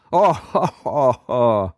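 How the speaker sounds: high howling villain laugh; hyena laugh. A narrator's reading. Enchanter laughing like a hyena over prey. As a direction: howl with laughter. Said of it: "like a hyena howling".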